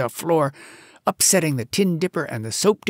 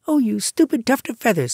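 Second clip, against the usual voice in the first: cross, higher and louder mongoose; angry; 9 semitones higher, 4 dB louder than usual. A narrator's reading. Mongoose scolds the singing bird, angry. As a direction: scold the silly bird sharply. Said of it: "said Rikki Tikki angrily".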